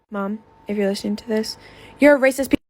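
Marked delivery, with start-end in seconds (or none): 2.0-2.6 louder, higher, cutting it off hard; angry. Real, not acted. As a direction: spit it straight at her mom. Angry address to her mom.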